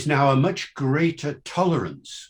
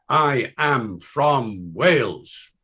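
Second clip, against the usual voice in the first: slow, word-by-word reading; deliberate and spaced, each word given weight; reading out a badge; much slower than usual. Shown as a demonstration. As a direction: spell it out slowly like a printed slogan. Reads out the wording on badge.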